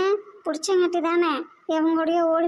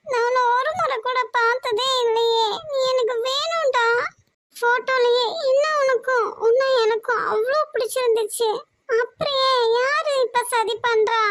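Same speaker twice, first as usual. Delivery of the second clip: higher, crying plea for help; tearful pleading; 5 semitones higher than usual. Acted. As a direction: sob out the plea to be rescued. (அழுதபடி கெஞ்சுதல்)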